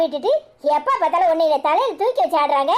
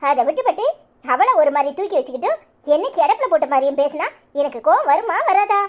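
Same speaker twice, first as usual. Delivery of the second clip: ordinary talk, nothing marked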